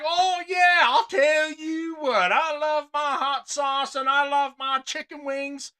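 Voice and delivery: loud, high, drawn-out Southern caricature; hammed-up Southern drawl, stretching the words; mock Southern accent. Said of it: "talking like you're from fucking Southern Virginia".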